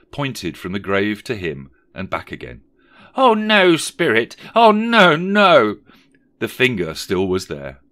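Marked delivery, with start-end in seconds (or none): 3.1-5.8 very high, loud wail of denial; horrified cry. Acted. Scrooge refusing his fate before the spirit, horrified. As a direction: wail it out in theatrical dread.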